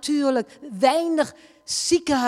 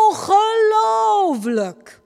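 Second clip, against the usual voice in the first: slow, high, loud cry of disbelief; amazed shout; 10 semitones higher, 6 dB louder, much slower than usual. Retold or imitated. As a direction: stretch it out in stunned wonder. Translator stunned by the miracle.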